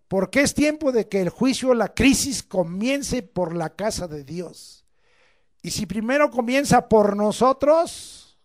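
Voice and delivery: loud, slow, weighty reading; solemn scripture reading. Acted. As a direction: read it out like judgment.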